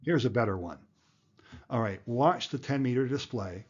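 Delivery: normal talking voice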